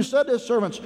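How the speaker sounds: ordinary delivery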